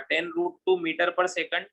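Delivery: slow, word by word, as if writing on the board; slow dictation. Shown as a demonstration. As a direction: spell out the answer slowly for notes. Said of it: "direction के साथ अगर मैं लिखूंगा".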